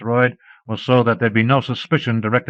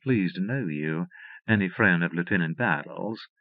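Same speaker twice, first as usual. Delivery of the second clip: faint southern drawl; 4 semitones lower, 7 dB quieter than usual. A narrator's reading. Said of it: "with a faint southern drawl".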